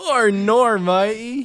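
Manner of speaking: high, loud mock Aussie; hammed-up Australian with stretched vowels; bad Australian accent. Teasing an Australian friend with a bad Aussie accent. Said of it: "my Australian accent's really bad".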